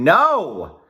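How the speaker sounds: short sharp loud no; silent scream. Acted. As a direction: blurt out a desperate no. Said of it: "I want to jump up and scream".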